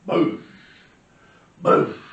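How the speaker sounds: loud shouted booms; explosion booms. Retold or imitated. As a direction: punch each boom like a blast. Imitating firecracker explosions.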